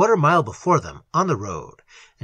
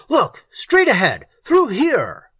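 raised, high, pointing-out call; urgent shout; 8 semitones higher, 5 dB louder, slower than usual. A narrator's reading. Little directing Algernon's eyes ahead, shouting. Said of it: "shouted Little".

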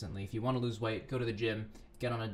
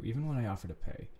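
plain reading voice

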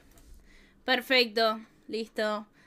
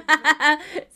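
laughing louder; 3 semitones higher, 8 dB louder than usual. Real, not acted. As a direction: laugh out loud. Laughing at the troll.

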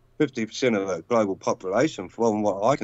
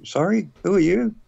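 a lightly voiced dismissive president, raised; 6 semitones higher, 4 dB louder than usual. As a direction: brush off the small-country visitor. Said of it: "I'd be like".

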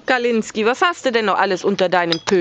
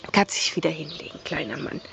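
lower, softer, fast gentle murmur; soothing a child; 6 semitones lower, 8 dB quieter, faster than usual. Acted. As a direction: hush him back to sleep. Settling cat back down.

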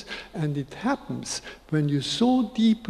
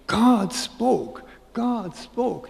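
high, awed outcry; ecstatic exclamation; 6 semitones higher, much slower than usual. Acted. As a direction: imitate a rabbi overwhelmed with ecstasy, pitch raised. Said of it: "he was already in ecstasy".

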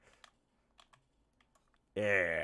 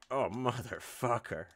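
frustrated yell; 7 semitones higher, 10 dB louder than usual. Real, not acted. Game mishap.

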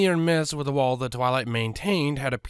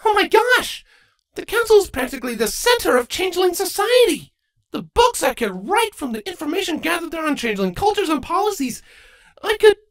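much higher and louder, gushing; giddy high squeal of delight; excited; 6 dB louder than usual. Acted. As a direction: burst out thrilled, rushing on. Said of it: "Twilight's eyes lit up".

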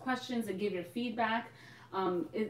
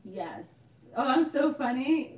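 usual speaking voice

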